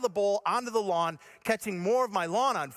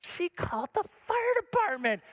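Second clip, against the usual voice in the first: high, squealed indignation; mock outraged disbelief; 11 semitones higher than usual. Husband hearing the fire truck, high outraged mock disbelief. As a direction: cry out high in mock betrayal.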